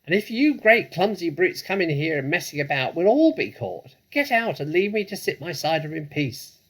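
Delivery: high, loud squeaky rat scolding; angry rat. Acted. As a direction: snap at the intruders in a shrill rat voice.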